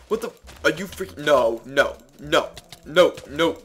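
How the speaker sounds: frantic rising no no no; losing it after a sudden death; raging gamer. Real, not acted. Dies to enemy mid-fight.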